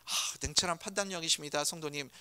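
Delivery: lightly ironic flattery; ironic compliment. Retold or imitated. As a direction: praise with a hidden jab. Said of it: "그거 칭찬 아니에요".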